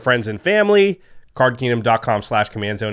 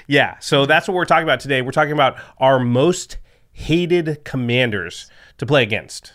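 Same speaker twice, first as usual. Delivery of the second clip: usual speaking voice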